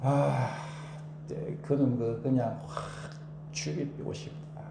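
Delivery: low, whispered at the end; muttered grudge. Shown as a demonstration. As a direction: grumble it under your breath. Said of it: "이렇게 하면 안 된다". (중얼거리는 원망)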